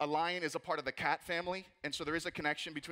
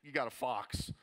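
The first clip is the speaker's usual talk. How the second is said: unimpressed; 6 semitones lower, slower than usual. Pretend. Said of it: "everyone's like".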